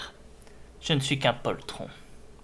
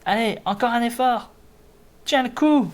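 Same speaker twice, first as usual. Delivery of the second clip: encouraging shout; 9 semitones higher, 6 dB louder than usual. Acted. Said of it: "J'ai dit les cris, je les fais pas".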